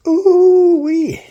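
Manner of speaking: high, loud delighted whoop; excited. Real, not acted. Tasting cooked dish, delighted.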